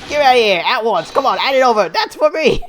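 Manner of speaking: loud, high, greedy British inspector; eager demand. Acted. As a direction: demand it at once at full volume. Inspector demands his gift.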